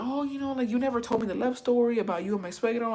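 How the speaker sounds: high-pitched coaxing, retold; change the subject sweetly to distract her; distracting chatter. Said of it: "I was trying to distract her".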